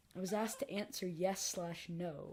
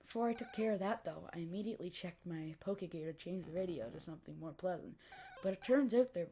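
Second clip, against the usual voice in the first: usual speaking voice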